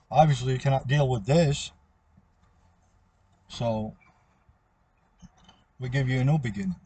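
ordinary delivery

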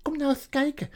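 nearly dancing with joy as you speak; joyful beaver. Acted. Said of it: "die bijna stond te dansen van blijdschap".

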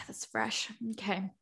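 ordinary talk, nothing marked

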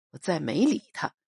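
plain reading voice

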